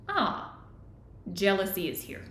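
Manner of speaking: soft, unhurried noticing after a light "ah"; calm witnessing. Shown as a demonstration. Witness greeting jealousy let into the room, calm. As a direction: observe it gently, with space between phrases. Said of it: "you've invited it into the room".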